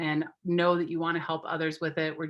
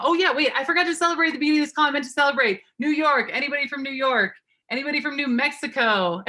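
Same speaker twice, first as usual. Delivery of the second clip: excited; 7 semitones higher, 4 dB louder, faster than usual. Real, not acted. Sudden excited celebration call.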